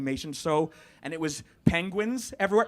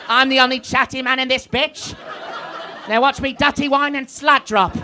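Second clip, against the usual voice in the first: loud, higher camp police captain; hammed-up comic impression reading someone their rights; Alan Carr as captain; 5 semitones higher, 5 dB louder than usual. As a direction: play the captain as a camp comedian. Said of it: "Can you imagine him as the captain".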